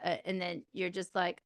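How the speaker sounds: ordinary talk, nothing marked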